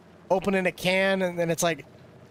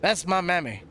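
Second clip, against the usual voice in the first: normal talking voice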